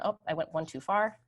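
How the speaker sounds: ordinary delivery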